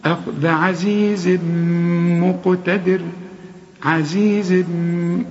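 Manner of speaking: عزيز مقتدر slow, tanwin merged into the mim with ghunna; tajweed idgham with ghunna; nasal merge. Shown as a demonstration. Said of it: "اسمع".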